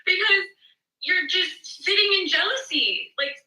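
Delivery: high-pitched, exasperated telling-off; exasperated retort. Retold or imitated. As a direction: plead with mom in rising frustration. Exasperated retort to her mother.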